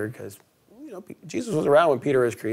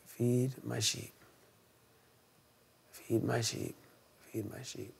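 slow, fading softer each time; tender charge; 13 dB quieter, slower than usual. Retold or imitated. Jesus charging Peter, fading from soft to near silent. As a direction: let it fade to a hush.

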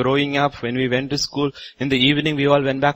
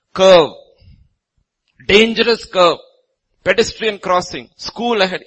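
called-out warning; 8 semitones higher, 8 dB louder than usual. Acted. Preacher calling out road warning signs.

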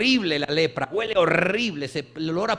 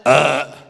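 loud low disgusted "ah"; mock disgust grunt; 7 semitones lower, 10 dB louder than usual. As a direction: grunt of distaste. (gruñido fingido de asco)

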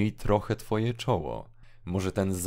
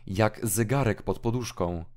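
ordinary talk, nothing marked

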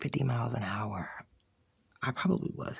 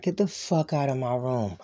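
angry retold outburst; 3 dB louder than usual. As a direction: re-enact ordering someone out, rising to a shout. Retold quarrel, ordering her out.

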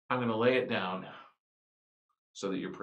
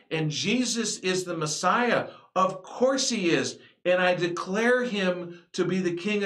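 preaching at full force; 5 semitones higher than usual. Acted. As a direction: build the proclamation with fervor.

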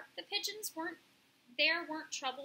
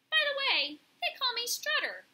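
high, loud, proud pigeon voice; Strutter; 11 semitones higher, 7 dB louder than usual. A narrator's reading. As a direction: introduce yourself as a cocky pigeon. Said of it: "said the pigeon".

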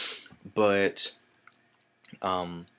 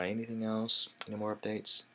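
much softer, thinking aloud; musing to himself; 12 dB quieter than usual. Real, not acted. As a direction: ask it quietly, as if checking your own memory. Asks himself while recalling updates.

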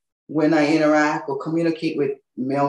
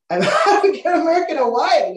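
high, louder, laughing through it; laughing reply; 10 semitones higher, 4 dB louder than usual. Retold or imitated. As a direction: laugh as you insist on the answer. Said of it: "And I was like".

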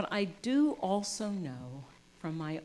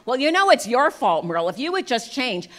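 louder, accusing push on Merle; blaming rebuke; 5 semitones higher, 11 dB louder than usual. Shown as a demonstration. Blaming her partner Merle, loud accusing rebuke. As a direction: scold him, much louder. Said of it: "it's gonna go something like this".

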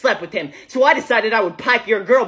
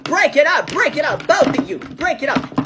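breaking up a fight; 6 semitones higher than usual. Acted. Stopping a fight between two men.

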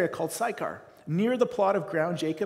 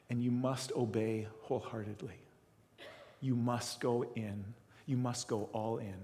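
gentle divine whisper; 4 semitones lower, 6 dB quieter than usual. Retold or imitated. Jesus speaking softly to the broken pastor, tender. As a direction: speak as a loving voice that calls. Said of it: "he whispers back to me".

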